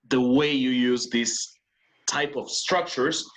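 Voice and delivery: slow explanation. Shown as a demonstration. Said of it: "teacher stresses the rule".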